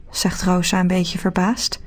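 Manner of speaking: usual speaking voice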